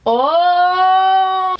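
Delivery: high, loud gasp of wonder; drawn-out amazed ooh; long delighted "oh". Real, not acted. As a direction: marvel aloud on one long note. Lights come on, she marvels.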